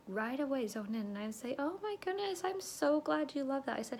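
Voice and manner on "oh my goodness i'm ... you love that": high-pitched gushing; delighted response. Retold or imitated. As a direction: gush back at a happy customer. Acts out her delighted response.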